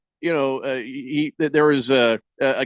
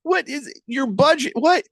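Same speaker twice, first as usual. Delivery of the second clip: put-on indignant old broadcaster; 9 semitones higher than usual. Mock outraged rant as Sterling.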